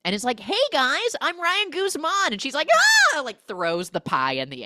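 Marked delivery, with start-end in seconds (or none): 0.4-2.3 high, loud self-introduction; mock chipper greeting. Retold arrival's breezy self-introduction. 3.1-3.2 high panicked shriek; mock startled scream. Retold startled woman throws the pie.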